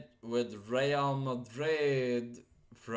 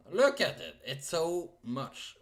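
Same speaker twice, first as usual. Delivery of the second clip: excited; 5 semitones higher, 4 dB louder than usual. Real, not acted. Showing the trophy list, amazed.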